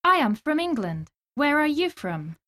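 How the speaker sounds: usual speaking voice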